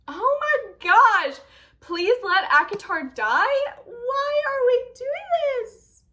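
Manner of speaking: high, drawn-out wail of dismay; anguished wail. Real, not acted. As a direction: cry out in exasperated despair, stretching the words. Anguished reaction to harsh review.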